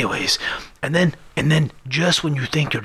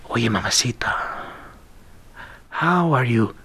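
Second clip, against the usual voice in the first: put-on whispered, stretched Spanish-accented come-on; Banderas flirting; 5 semitones lower, slower than usual. Banderas impression greeting a woman at the bar, whispered. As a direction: whisper it slow to a beautiful woman. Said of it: "you gotta have to stretch it out".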